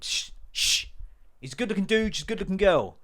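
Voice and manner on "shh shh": unvoiced hush; a quick hushing hiss; shushing. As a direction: hush the room.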